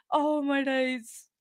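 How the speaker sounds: ordinary talk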